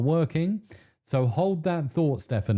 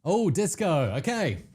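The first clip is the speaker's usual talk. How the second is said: usual speaking voice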